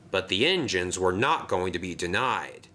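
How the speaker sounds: usual speaking voice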